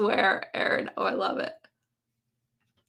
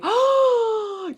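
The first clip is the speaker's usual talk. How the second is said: surprised gasp; 13 semitones higher, 3 dB louder than usual. Real, not acted. Hearing of celebrity Alexa voices.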